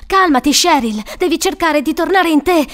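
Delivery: loud, urgent pleading; desperate. A narrator's reading. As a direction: plead desperately to bring someone round. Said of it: "Frank era disperato".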